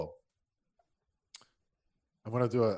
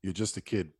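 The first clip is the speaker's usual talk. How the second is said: put-on light Boston accent; 8 semitones lower, 8 dB quieter than usual. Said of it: "you put on a Boston accent in the end".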